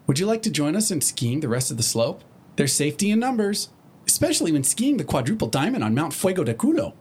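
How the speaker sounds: high, bubbly pony voice; chirpy, eager pony; Pinkie Pie voice. Acted.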